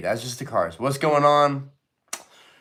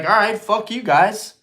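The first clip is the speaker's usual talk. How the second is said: defiant brush-off; 8 semitones higher, 3 dB louder than usual. Retold or imitated. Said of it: "be like".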